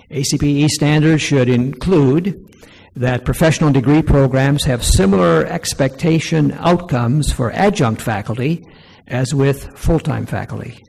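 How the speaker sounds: loud, projected reading of a formal statement; formal read-aloud. Real, not acted. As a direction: read it out firmly to a room. Reads numbered recommendation aloud to audience.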